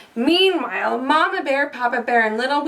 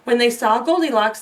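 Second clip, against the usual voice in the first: ordinary delivery, nothing marked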